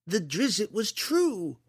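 put-on very high, slow boy's protest; insistent protest. A narrator's reading. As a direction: dig in, stretching each word. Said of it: "Liam protested".

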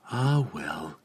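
soft, sighed resignation; disappointed sigh. A narrator's reading. Lady without news of her friend, disappointed sigh. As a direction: let it out quietly, sincerely let down. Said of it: "Illustrial sighed, sincerely and obviously disappointed".

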